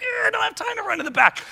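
high-pitched frantic squeal, played for laughs; mock panic. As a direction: act out a comic last-minute fluster. Retells panic before preaching.

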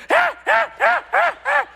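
very high, shouted ape calls; monkey troop cheering. Retold or imitated. Monkeys in the trees cheering, very high shouted calls. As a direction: yell like the whole troop in the trees. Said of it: "all the monkeys are like".

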